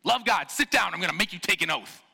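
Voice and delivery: put-on high, loud barking at kids; hammed-up bossy church leader; mock strict pastor. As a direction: order the kids about like a stern preacher. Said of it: "Could you imagine that?".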